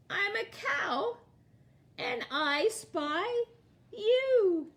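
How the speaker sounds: put-on spring the twist on the kids; playful surprise. Teacher surprising the children at the book's end, playful and bright.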